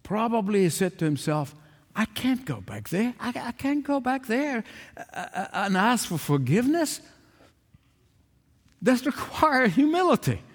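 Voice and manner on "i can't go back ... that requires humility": higher-pitched, softened, stammering objection; reluctant protest. Acted. Lost son arguing with himself about going home, reluctant. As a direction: balk at it, stumbling over the words.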